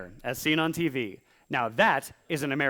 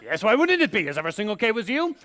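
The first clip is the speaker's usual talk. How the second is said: put-on higher, defensive French-accented raccoon; 5 semitones higher, 4 dB louder than usual. Caught-out teen as raccoon answering mom, mock French accent. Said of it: "the raccoon has a French accent".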